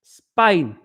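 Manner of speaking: "Spain" in broad Cockney, falling at the end; playing up the Cockney vowel in "Spain"; Cockney accent. Shown as a demonstration. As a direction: say "Spain" like a Cockney, one word at a time. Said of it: "can't say Spain without a Cockney accent".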